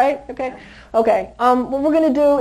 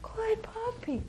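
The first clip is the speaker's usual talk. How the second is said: much softer, higher, slow good puppy; soft high praise; 11 semitones higher, 14 dB quieter, slower than usual. Real, not acted. Trainer praising the sitting puppy: soft high coo.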